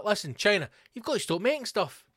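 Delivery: high, exasperated appeal; mock pleading critics. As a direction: plead indignantly as the worried world. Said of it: "everyone's like".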